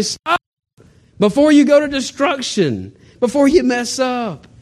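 high, pleading cry to stop, slowing at the end; urgent plea. Retold or imitated. Friends warning him before destruction, high urgent plea. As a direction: call out to stop them, drawing out the last words.

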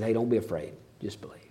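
hushed, gentle comfort fading out; quiet tender encouragement; soft reassurance. Acted. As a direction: soothe a frightened dad. Jesus speaks to daddy's face.

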